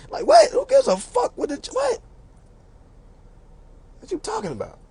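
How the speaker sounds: high, baffled disbelief; incredulous high squeak. Retold or imitated. Incredulous retort.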